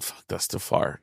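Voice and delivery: soft unvoiced whisper; whispering to himself it's too far; whispered thought. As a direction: breathe it like a private thought. Thinking aloud about Australia.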